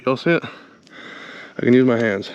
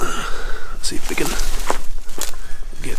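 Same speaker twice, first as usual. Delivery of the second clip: hushed whisper while sneaking; 5 semitones lower, 3 dB louder, slower than usual. Real, not acted. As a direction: whisper so nothing hears. Creeping quietly through the woods.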